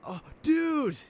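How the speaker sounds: stoner surfer dude. Pretend. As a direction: throw it way up like a Bill and Ted stoner. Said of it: "it's like Bill and Ted".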